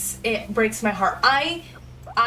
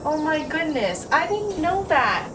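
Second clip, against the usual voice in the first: surprised; 6 semitones higher than usual. Real, not acted. Streamer reacts to game reveal.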